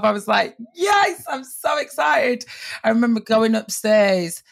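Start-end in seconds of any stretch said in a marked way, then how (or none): 0.8-2.4 high, elated exclamation; beside herself excited. Retold or imitated. Reacting to agent's news of a new job, overjoyed. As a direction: burst out with thrilled delight, pitched way up. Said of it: "I was beside myself".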